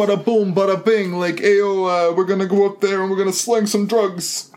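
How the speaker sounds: high mock New York mobster accent. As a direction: ham up a New York wiseguy. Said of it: "they have thick New York accents".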